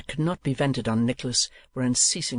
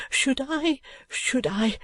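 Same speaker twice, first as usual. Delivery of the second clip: put-on eager pleading boy; 12 semitones higher, slower than usual. A narrator's reading. Smike clutching for hope.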